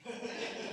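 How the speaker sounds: a short laugh under the breath; quiet self-amused chuckle; soft laugh. Real, not acted. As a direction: chuckle at your own joke. Laughs at own tired joke.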